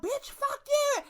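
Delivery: high, slow put-on woman's snarl; angry driver. Angry woman driver cussing her out at the exit, high snarl. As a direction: fling it out the car window.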